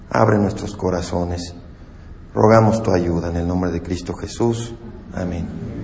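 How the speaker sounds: low, soft, humble prayer. Real, not acted. Pastor praying to God before the sermon, soft humble prayer. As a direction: pray quietly and humbly for the church.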